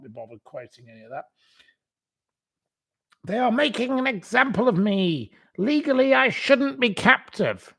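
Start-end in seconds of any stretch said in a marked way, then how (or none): 3.2-7.7 high, indignant put-on voice; mock victim. Mocking her complaint to viewers, high and indignant. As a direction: whine it like a wronged prisoner.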